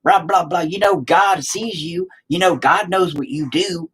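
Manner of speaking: mock a finger-wagging churchgoer; lightly hammed-up scolding believer. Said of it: "they would tell me every damn time".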